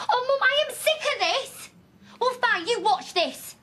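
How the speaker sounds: high-pitched, defiant anger; fed-up outburst. Acted. Daughter storming out on disbelieving mother, fed-up high outburst. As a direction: throw it at her, pitched right up.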